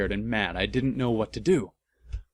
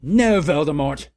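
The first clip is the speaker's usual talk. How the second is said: louder defiant no; mock-heroic shout; shouted refusal; 3 semitones higher, 6 dB louder than usual. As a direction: shout it back at him. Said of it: "I shouted back".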